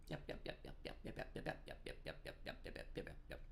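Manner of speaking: breathless whispered string of yeps; murmured rapid yep yep yep. Real, not acted. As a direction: rattle off yep under the breath. Rapid yeps while fiddling with settings.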